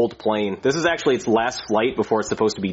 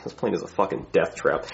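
ordinary talk

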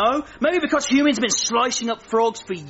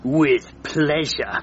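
much lower, slow menace; slow villain vow; 6 semitones lower, much slower than usual. Acted. Frogger vows revenge, slow low menace. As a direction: say it slowly, like a villain.

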